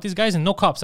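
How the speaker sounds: ordinary talk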